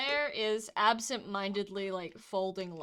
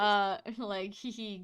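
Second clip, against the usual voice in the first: ordinary delivery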